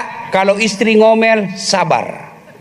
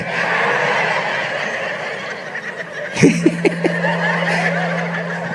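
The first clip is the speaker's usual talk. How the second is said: breathy laugh; 7 dB quieter than usual. Real, not acted. Preacher laughs at own joke.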